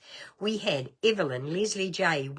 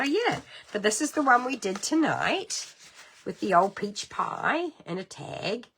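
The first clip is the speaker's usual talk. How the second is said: ordinary talk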